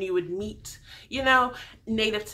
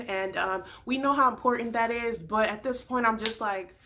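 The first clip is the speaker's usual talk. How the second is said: normal talking voice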